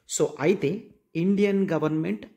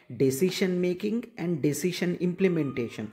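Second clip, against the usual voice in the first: slow dictation; much slower than usual. Shown as a demonstration. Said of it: "teacher dictating the definition".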